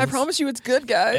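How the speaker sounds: high pleading voice. Retold or imitated. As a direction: beg them to listen.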